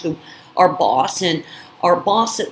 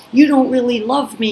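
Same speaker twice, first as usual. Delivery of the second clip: louder, higher mock yell at her; 6 semitones higher, 4 dB louder than usual. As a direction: partner yelling at her, accusing, in a retold shout. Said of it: "and him screaming".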